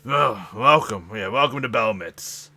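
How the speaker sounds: loud, slow, oily greeting; smothering welcome. Acted. Greasy harbor official welcoming the crew, loud and slow. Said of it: "he just smothers".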